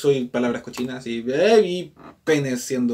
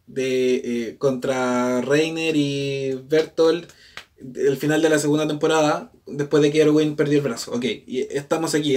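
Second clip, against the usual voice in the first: normal talking voice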